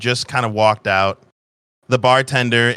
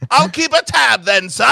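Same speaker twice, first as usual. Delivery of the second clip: loud, high Cockney robot bartender; booming mock-Cockney barman greeting a customer; bad Cockney accent; 12 semitones higher, 5 dB louder than usual. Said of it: "loud as shit in his bad Cockney accent".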